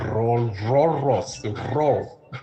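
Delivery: "Roll" with a trilled R at the start. Shown as a demonstration. Teacher showing learners how to practice, trilled R in "roll". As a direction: roll the R at the front of "roll". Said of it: "practice rolling your R".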